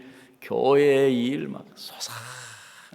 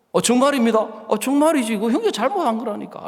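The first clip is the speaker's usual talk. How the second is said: emphatic insistence; 9 semitones higher, 5 dB louder than usual. Retold or imitated. Acted exchange, insisting loudly.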